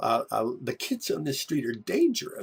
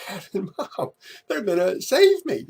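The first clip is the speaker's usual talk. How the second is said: much higher and louder, eager relief; hopeful excitement; 8 semitones higher, 7 dB louder than usual. Retold or imitated. Kid spotting his parents' car, eager and loud. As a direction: cry out with sudden hope.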